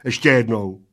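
loud, firm ruling at ordinary speed; decisive. A narrator's reading. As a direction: settle it as the one in charge. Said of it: "rozhodl plešatec". (rozhodně, hlasitě)

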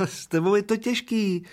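put-on high, whiny little old man's lament. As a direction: complain like a tiny old man who is fed up. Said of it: "naříkal maličký stařík".

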